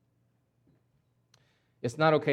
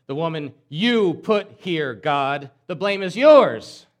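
slow, much louder, rising accusation; Adam accusing God; 9 dB louder, slower than usual. Acted. Adam blaming God for the woman, slow and loud. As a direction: throw the blame back hard and slow.

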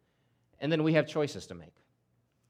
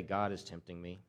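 "God is tempting me" dropped much softer and lower; soft repeat; 9 semitones lower, 12 dB quieter than usual. Acted. Preacher echoing the excuse again, much softer and lower. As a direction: let "God is tempting me" fall away to a near hush.

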